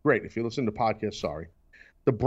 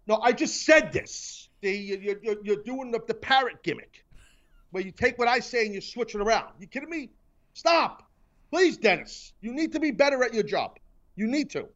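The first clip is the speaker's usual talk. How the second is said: mock scolding; 8 semitones higher than usual. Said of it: "While I'm yelling at you".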